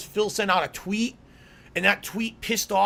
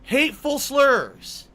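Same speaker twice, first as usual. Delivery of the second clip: slow, louder outrage; explosive rage; 5 semitones higher, 5 dB louder, slower than usual. Real, not acted. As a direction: stretch it out, incredulous. Repeats accusation again.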